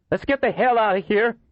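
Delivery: urgent high-voiced character line; urgent escape. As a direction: call out to get everyone moving. Urgent escape in acted story.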